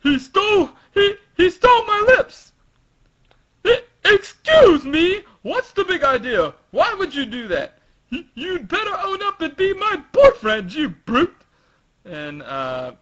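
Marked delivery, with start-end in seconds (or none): 0.0-2.5 put-on shocked girl. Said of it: "And then she says". 3.7-11.3 put-on flustered angry girl. Shocked girl scolds Frankie.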